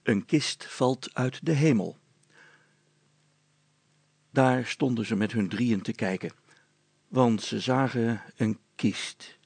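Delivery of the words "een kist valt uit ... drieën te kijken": usual speaking voice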